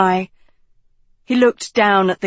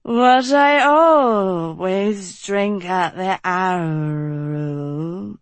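mumbled; much slower than usual. Acted. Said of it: "Dot mumbled".

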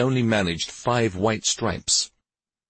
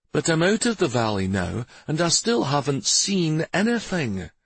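high, emphatic voice of a longing wife; eager, yearning wife; 7 semitones higher than usual. A narrator's reading. Wife telling her husband she longs to travel, yearning. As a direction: press your wish to travel, pitched high.

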